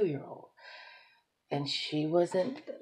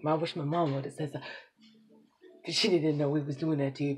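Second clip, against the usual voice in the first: ordinary talk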